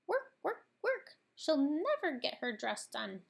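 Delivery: very high, squeaky little mouse. A narrator's reading. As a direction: chatter like a fretful tiny mouse. Mouse complains about Cinderella's chores.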